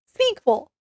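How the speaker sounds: Sequel! jumps high, bright and exclaimed; triumphant word found. Real, not acted. Reviewer finally finds the word, exclaims Sequel! high. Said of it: "I knew I'd get the word eventually".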